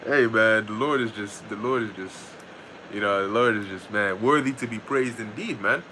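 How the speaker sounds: ordinary talk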